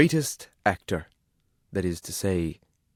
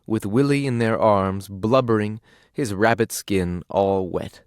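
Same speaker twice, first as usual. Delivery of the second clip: plain reading voice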